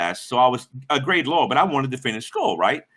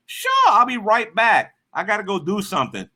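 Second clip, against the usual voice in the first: fake agreeable; 7 semitones higher than usual. Driver ditches the bum with an excuse, fake agreeable. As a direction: agree too readily to get away.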